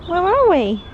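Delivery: put-on high wondering little-kid voice; imitating excited toddler. Mum voicing her excited child, high cooing wonder. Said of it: "He's just going".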